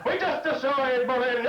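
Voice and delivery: mock bomber crew radio; bomber pilot. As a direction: announce the hit like a war pilot.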